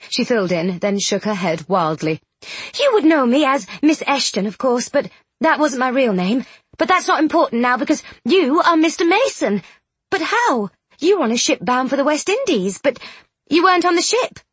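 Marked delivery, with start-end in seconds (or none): 2.8-14.4 excited. Acted. Charlotte excited, high voice.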